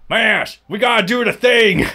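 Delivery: Canadian doing a Texan voice; Texas accent. Pretend. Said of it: "Canadians doing stereotypical Texas voices".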